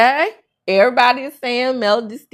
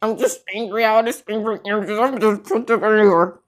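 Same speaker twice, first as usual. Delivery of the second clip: mock angry Trish. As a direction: mimic a sullen woman muttering how angry she is. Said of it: "every scene she's in".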